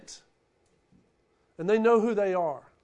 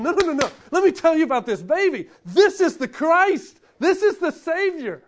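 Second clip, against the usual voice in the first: higher and louder, insisting on the good news; eager shepherd who won't let anyone talk about anything else; No, no, no, the baby is the real story, said with joy; excited bragging; 9 semitones higher, 9 dB louder than usual. Retold or imitated. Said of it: "That's what they were bragging about".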